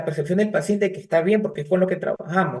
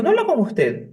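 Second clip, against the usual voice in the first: surprised; 10 semitones higher than usual. Retold or imitated. Therapist asks mother, surprised.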